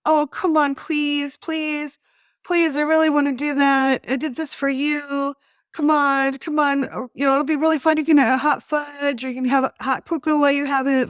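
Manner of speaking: high, loud wheedling please; pleading pout. Shown as a demonstration. To Rick about ice cream, a high pleading pout. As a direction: whine and beg like a pouting child. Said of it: "I can pout".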